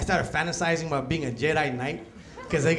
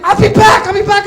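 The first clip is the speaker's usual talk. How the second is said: angry threat; 13 semitones higher, 13 dB louder than usual. Retold or imitated. Girlfriend storming out.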